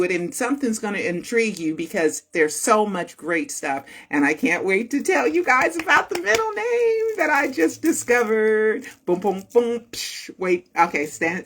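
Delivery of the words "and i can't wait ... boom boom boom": teasing fanfare. Pretend. Host teasing viewers about a new discovery, mock-dramatic. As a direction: tease the big reveal in a rising, stretched voice.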